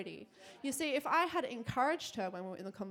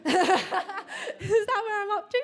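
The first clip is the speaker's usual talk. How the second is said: laughing; 10 semitones higher, 10 dB louder, much slower than usual. Real, not acted. Laughing check of her notes.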